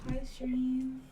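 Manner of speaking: loud greeting from across the room; calling out. Real, not acted. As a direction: call out hi to the stream.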